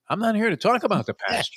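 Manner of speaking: lightly mocking, high-pitched dodge of a witness; evasive witness. Ballplayer stonewalling congressmen at the steroid hearings, evasive. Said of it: "the most evasive answer".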